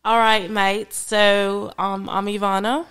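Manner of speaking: put-on British accent. Co-host closing the podcast on request, British accent. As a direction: sign off in a playful British accent. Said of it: "close out in your British accent".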